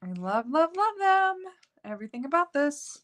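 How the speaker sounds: gushing. Real, not acted. Gushing over hearts.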